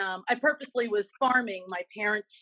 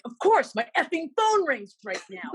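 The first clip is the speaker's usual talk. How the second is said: annoyed outburst; 5 semitones higher, 4 dB louder than usual. Retold or imitated. Stressed in grocery line, phone rings.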